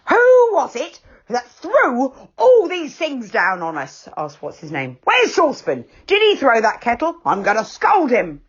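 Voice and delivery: What's-His-Name demanding who threw things; angry demand. A narrator's reading. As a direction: demand the culprit. Angry neighbour demands culprit.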